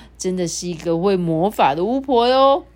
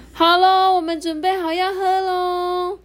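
much higher, eager announcement; can't wait to eat; high cheerful chorus; excited animals; 11 semitones higher than usual. A narrator's reading. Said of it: "大家就说".

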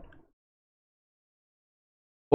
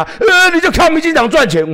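jeering accuser; 10 semitones higher than usual. Retold or imitated. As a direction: taunt him with the charge. Heckler accuses him of profiting.

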